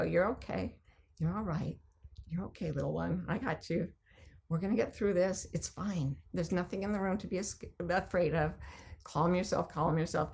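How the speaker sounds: soft, tender, reassuring murmur; calm yourself like holding a frightened baby; soothing self-talk. Shown as a demonstration. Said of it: "where you sit there and go".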